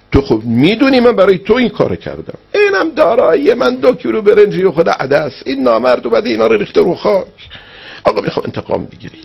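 high, tearful pleading, imitating a wronged man; retold tearful plea. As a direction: act out a weeping complaint, pitch soaring then sinking at the end. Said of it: "گفت خیلی گریه کردم".

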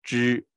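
之 pronounced alone as a clear retroflex zhi; zhi taken apart. Shown as a demonstration. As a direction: set 之 out by itself before its vowel. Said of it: "我拆开就是".

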